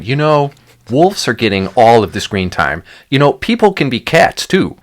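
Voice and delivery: mock indignant complainer. Acting out an imagined movie pitchman.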